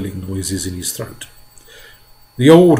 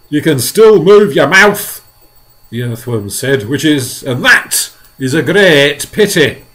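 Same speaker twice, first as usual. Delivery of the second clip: loud, dry sarcastic jab; earthworm retort; 4 semitones higher, 11 dB louder than usual. A narrator's reading. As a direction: needle the centipede.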